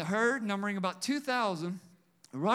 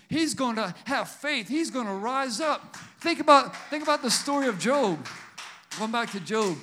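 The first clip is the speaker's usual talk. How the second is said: loud exhortation; 3 semitones higher, 6 dB louder than usual. Acted. Loud call to congregation.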